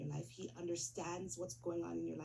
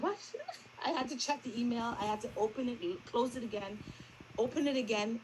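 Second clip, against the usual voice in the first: louder, higher excited retelling; amazed, rising and louder; stunned disbelief; 4 semitones higher, 5 dB louder than usual. Real, not acted. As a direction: relive the shock of the good news. Disbelief at passing email.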